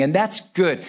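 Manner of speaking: ordinary delivery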